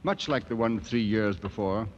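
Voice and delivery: measured reflective voice-over; calm narrator over music; story narration. A narrator's reading. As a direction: tell it like a memory.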